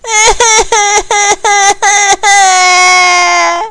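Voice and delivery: high, sustained bawling wail; loud acted crying. A narrator's reading. Crying that wakes the whole orphanage, loud wail. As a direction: bawl long and high, pretending to cry. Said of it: "The crying woke up the whole".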